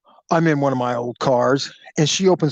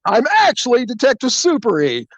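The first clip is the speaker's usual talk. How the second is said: high-pitched, emphatic announcement; spring the big gotcha; hammed-up detective unmasking himself; triumphant reveal; 8 semitones higher than usual. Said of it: "like I did the aha".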